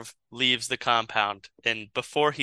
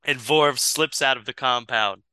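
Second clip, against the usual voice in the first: normal talking voice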